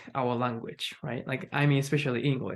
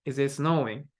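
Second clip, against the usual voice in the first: "snowing" stays down, no question lift; wrong falling intonation. Shown as a demonstration. Teacher repeats the mistaken version, falling intonation. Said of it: "It's not like".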